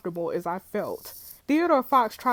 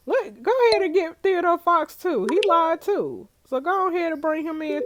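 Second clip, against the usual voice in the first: high, loud, eager commands; excited orders; 9 semitones higher, 5 dB louder than usual. Real, not acted. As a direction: bark it out with glee. Ordering suspects brought in.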